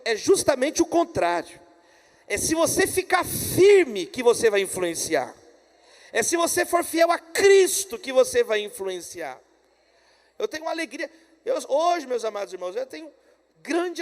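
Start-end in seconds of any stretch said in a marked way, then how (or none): 2.2-9.4 loud, forceful declaration to the congregation; emphatic preaching. Real, not acted. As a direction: drive the point home loudly. Sermon's emphatic main point.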